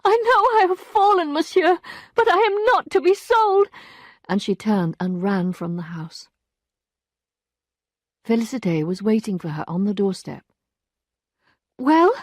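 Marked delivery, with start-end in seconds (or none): 0.0-3.7 high, loud indignant cry. A narrator's reading. 11.8-12.1 high, loud servant's voice; the maid breaking bad news, high and loud; distressed maid. A narrator's reading. Maid's voice.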